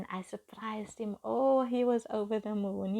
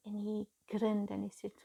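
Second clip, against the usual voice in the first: ordinary talk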